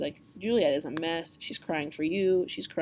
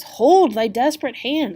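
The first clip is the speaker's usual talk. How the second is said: raised, louder commanding friar; stern friar; 6 semitones higher, 8 dB louder, slower than usual. Acted. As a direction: stop him with a sharp raised call. Friar stops Romeo's desperate hand.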